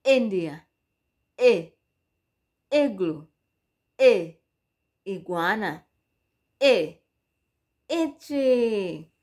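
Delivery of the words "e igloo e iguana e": "E" said as a short punchy vowel. Shown as a demonstration. Teacher sounding out for children in Swahili, punchy vowel. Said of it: "inatamkwa E".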